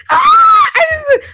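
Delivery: high shrieking laughter; cracking up. Real, not acted. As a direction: lose it laughing at the story. Judge gasped story, cracking up.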